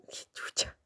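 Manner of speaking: breathless whispered swear; whispered aside. Real, not acted. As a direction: mutter it under your breath in disbelief. Reader's own whispered aside.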